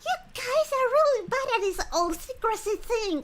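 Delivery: high, loud put-on voice; intruding game character. Game character mocking others' secrecy, loud and high. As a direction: project it high and loud, calling them out.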